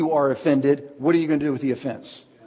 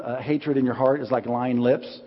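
usual speaking voice

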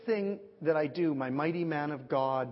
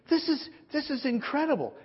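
high, halting astonishment; imitating a woman overwhelmed; retold amazement; 10 semitones higher than usual. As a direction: marvel at it, pitched high. Acting out Jan's amazement.

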